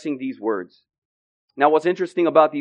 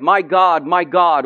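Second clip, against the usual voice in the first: preaching at full force. Acted. Preacher contrasting desolation and victory, impassioned. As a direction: pound it from the pulpit.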